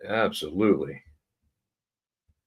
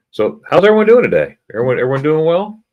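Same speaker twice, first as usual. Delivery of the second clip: cheerful greeting; 8 semitones higher, 8 dB louder, faster than usual. Real, not acted.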